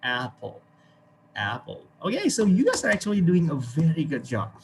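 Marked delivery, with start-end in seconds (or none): 0.0-1.8 American apple. Shown as a demonstration. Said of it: "the Americans use the ah sound".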